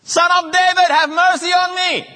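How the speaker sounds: very high, full-voiced desperate cry. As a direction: shout over those who hush you. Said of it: "he shouted even louder".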